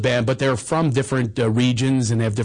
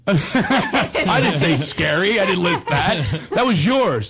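laughing; 5 semitones higher, slower than usual. Real, not acted. Laughing rebuttal to co-host.

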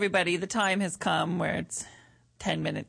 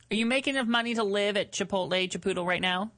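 ordinary talk, nothing marked

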